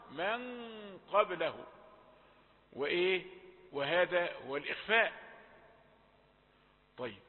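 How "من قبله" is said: long dark nasal hum in "من" before qaf; heavy ghunnah. Shown as a demonstration. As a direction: hum the n of "من" darkly into "قبله". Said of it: "فتيجي الغنة مفخمة". (غنة مفخمة في "من" قبل القاف)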